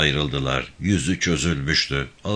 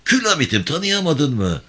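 ordinary delivery

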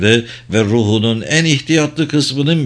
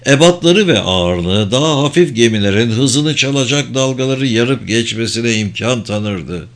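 ordinary delivery, nothing marked

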